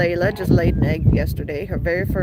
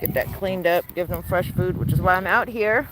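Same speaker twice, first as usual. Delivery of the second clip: normal talking voice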